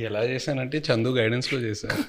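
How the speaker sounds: ordinary talk, nothing marked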